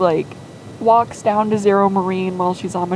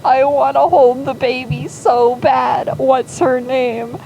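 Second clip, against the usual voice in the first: raised, louder mock-tearful plea; tearful longing; 5 semitones higher, 3 dB louder than usual. Zoran asking to hold his niece's baby, mock-tearful longing. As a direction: overcome uncle begging, hammed up. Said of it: "Zoran is in tears, and he is like".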